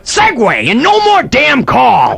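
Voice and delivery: high, clipped-off imitation of a frustrated host; a hammed-up cranky version of John Monez; mock John Monez. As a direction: play his annoyed inner voice. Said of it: "John Monez was thinking".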